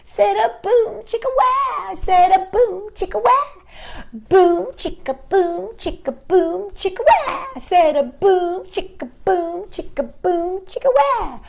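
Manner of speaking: put-on baby style. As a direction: chant it in a cute baby voice. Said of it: "Baby style".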